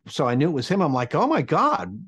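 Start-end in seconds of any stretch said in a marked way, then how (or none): 1.1-2.0 squeaky high disbelief, climbing up; stunned. Retold or imitated. Young intern stunned as the DJ phones him. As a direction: gasp it out, starstruck.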